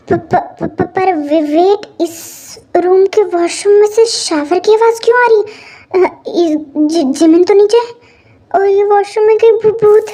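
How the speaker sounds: halting, stuttering fear of a ghost; scared stammering. Acted. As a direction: stammer nervously, stretching the words out. Hears shower, fears a ghost. (डर से हकलाते हुए)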